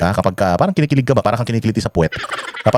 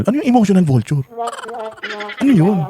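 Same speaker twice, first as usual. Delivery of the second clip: mocking disbelief; 10 semitones higher than usual. Real, not acted. Mocking the VP's odd phrase.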